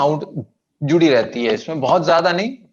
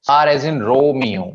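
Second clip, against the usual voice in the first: slow Romeo, RO stretched and stressed; stress on first syllable; much slower than usual. Shown as a demonstration. Teacher models Romeo for repetition, first syllable stressed. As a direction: hit the Ro of Romeo, mio short. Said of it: "पहले syllable पे ज्यादा stress देते हैं".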